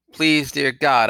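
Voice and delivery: high, loud plea through tears; tearful cry. A narrator's reading. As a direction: cry out to God, begging for help. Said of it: "I cried aloud, my eyes streaming hot tears".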